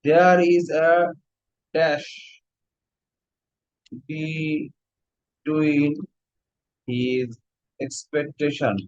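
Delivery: slow, word by word with gaps; dictating while writing. Real, not acted. Teacher writing an example for the class, slow dictation. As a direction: stretch each word as you write it.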